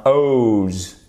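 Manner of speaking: long gliding "oh-oo" with buzzed ending on "O's"; careful English O. Shown as a demonstration. Teacher warns French learners about "O's", careful English O. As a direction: stretch "O's" as an English diphthong. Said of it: "il faut bien prononcer le O".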